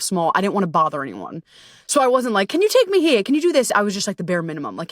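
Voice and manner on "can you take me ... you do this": high, bright pleading requests; mock needy ask. Retreat woman imagining asking friends for help, high pleading.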